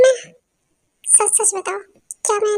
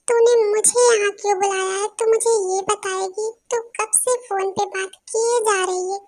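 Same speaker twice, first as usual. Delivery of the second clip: ordinary delivery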